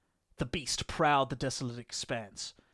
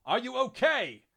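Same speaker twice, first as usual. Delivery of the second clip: high, loud shouted question; raised voice, carrying far; echoing call; 11 semitones higher, 6 dB louder than usual. A narrator's reading. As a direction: call out across a wasteland to a fallen comrade. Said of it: "The Astartes' voice echoed across the desolation".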